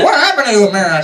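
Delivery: high, shocked cry; horrified. Retold or imitated. George finds Mary in a sensible dress, shocked and high. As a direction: recoil at the sight of her.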